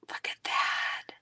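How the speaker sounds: soft unvoiced whisper; hushed admiring whisper. Real, not acted. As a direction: whisper it in awe.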